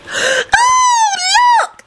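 put-on very high girlish squeal; delighted squeak. A narrator's reading. Hufflepuff girl rushing up to Neville, squeaking delightedly. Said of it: "squeaked delightedly".

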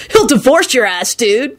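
lightly voiced know-it-all kid; knowing dude. A narrator's reading. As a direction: tease as if you know best. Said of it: "he added knowingly".

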